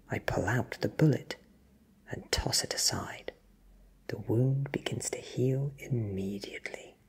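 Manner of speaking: ordinary delivery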